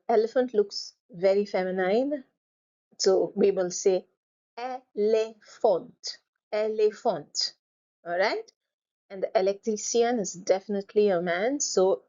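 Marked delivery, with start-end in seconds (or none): 4.6-7.5 "éléphant" slowly as ay - lay - font; syllable by syllable. Shown as a demonstration. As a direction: model "éléphant" in three spaced parts, falling. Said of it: "split your word into syllables".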